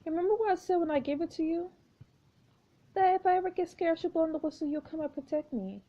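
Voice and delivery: put-on high little girl remembering; sweet high child's voice, pleading; childlike girl voice. Jane recalling the whistle, child voice.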